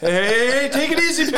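high, loud mock-protesting deer; deer's human voice. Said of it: "like, a human voice to deer".

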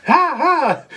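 high yelping ha ha; mock giddy-up cheer; gleeful whoop. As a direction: whoop like a cowboy taking off. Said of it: "He's like".

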